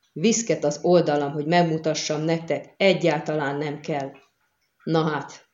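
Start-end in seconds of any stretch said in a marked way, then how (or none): none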